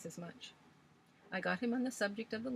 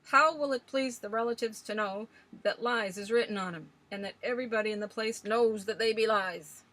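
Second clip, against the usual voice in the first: put-on old Swales ranting; 3 semitones higher, 10 dB louder than usual. A narrator's reading. Old man scoffing at legends to the young ladies, loud and gruff. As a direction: rail against ghost stories like a stubborn old sailor.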